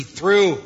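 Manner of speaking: preacher punching "through" to make the point; emphasis on THROUGH. Real, not acted. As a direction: hit the word "through" firmly. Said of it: "I emphasize that word".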